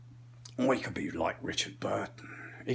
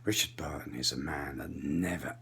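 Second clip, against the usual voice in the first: low, slow, quiet thespian menace; grave English actor, measured and hushed; Richard Burton; 8 semitones lower, 6 dB quieter than usual. Acted. Comic playing an old English actor, slow and low. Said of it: "you go into slow Richard Burton".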